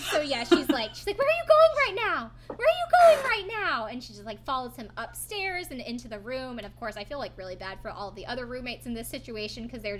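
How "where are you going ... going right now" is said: mock shout. Snooki shouting after him.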